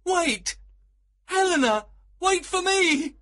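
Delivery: high, loud calling after someone; urgent cry. A narrator's reading. Lysander chasing Helena as she leaves, crying out. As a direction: call out desperately to stop her. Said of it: "cried Lysander".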